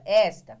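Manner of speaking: "Esta" said loud with a strong first syllable; stress on first syllable. Shown as a demonstration. Teacher showing children the word's sound, stressed loudly on ES. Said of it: "qual é o som dessa palavra".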